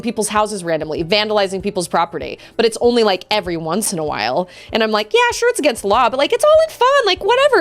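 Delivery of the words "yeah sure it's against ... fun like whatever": high-pitched airy imitation of an excuse-maker; mocking breezy brush-off; mock dismissive. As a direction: wave it away in a high mocking voice. Acts out a dismissive rule-breaker.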